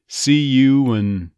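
"and" reduced to "n" in "See you and"; slow, loud "See you 'n"; syllabic N. Shown as a demonstration. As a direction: drop vowel and D from "and" in "See you and". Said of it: "this syllabic N".

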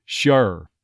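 Sure with an uh vowel, shur; Texas sure. Shown as a demonstration. An accent coach demoing Sure in Texas. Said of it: "almost that uh sound".

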